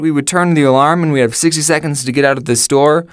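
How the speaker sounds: usual speaking voice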